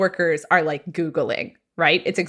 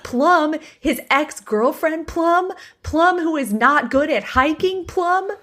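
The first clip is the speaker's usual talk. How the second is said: outraged disbelief; 8 semitones higher than usual. Retold or imitated. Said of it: "she can hear Hope at the drive-thru be like".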